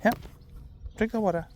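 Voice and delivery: high coaxing pet voice. Real, not acted. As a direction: coax the dog up high. Coaxing dog to drink.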